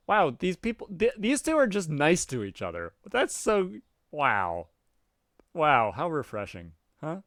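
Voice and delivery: loud delighted wow; amazed. Real, not acted. Reader delighted the two characters are nice, loud. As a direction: gush over how nice they are.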